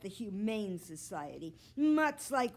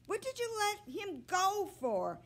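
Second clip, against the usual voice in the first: much higher, loud scolding yell; angry shout; 11 semitones higher, 4 dB louder than usual. A narrator's reading. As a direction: yell at your sister for losing the reward. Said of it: "he heard Joe yell".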